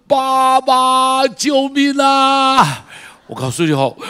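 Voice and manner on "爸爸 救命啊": much higher and louder, drawn-out plea; injured small boy crying out to his dad; child's desperate cry. Retold or imitated. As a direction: scream for your father in pain. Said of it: "趴在那里拼命喊着说".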